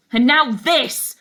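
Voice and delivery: loud, slow, furious burst; angry outburst. A narrator's reading. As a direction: slam the words down like the fist. Bangs fist on table.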